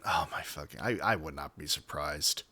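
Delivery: low, very soft aside; real low grumble to oneself; exasperated mutter. Real, not acted. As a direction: drop low and quiet in dismay. Exasperated realisation, voice drops low.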